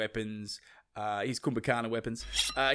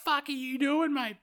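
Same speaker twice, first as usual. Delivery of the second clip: outraged wife. Acted. Sita outraged at Rama.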